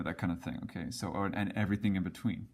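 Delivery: usual speaking voice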